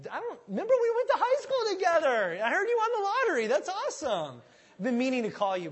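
high, loud, over-excited greeting; hammed-up long-lost buddy squeal; gushing fake friend. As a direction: suck up to a lottery winner. Acts fake friends greeting lottery winner.

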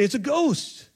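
high-pitched mock fright; hammed-up cry at seeing a ghost; mock terrified. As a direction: act scared, pitch jumping up. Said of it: "they're terrified".

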